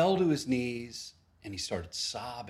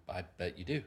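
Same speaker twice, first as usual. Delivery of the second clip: soft, knowing inner remark; a dry quiet thought; quiet wry thought about a friend; wry aside; 6 semitones lower, 9 dB quieter than usual. Retold or imitated.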